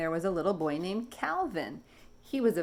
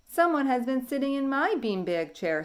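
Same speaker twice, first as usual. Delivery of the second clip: higher grumpy papa dinosaur; 7 semitones higher, 3 dB louder than usual. Acted. Papa finding his chair used, grumpy. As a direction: grumble like a cross father. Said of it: "said the papa in a grumpy voice".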